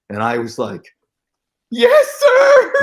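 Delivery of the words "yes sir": piercingly high, loud squeal, half laughing; nerded-out fanboy. Retold or imitated. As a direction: shriek it like a starstruck fan. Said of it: "I was so nerded out".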